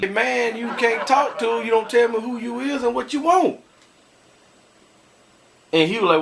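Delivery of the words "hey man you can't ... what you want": scolding. Retold or imitated. Telling off the caller.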